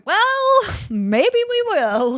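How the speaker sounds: high, drawn out teasing well; slow coy maybe we will; coy backtrack. Retold or imitated. Sony's reluctant backtrack quoted.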